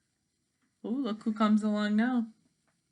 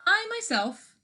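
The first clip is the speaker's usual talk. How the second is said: high prim hen; 12 semitones higher, 4 dB louder than usual. Acted. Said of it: "said the hen".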